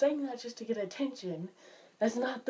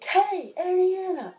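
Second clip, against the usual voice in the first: calling out; 9 semitones higher, 7 dB louder, slower than usual. Retold or imitated. Parent calling from another room.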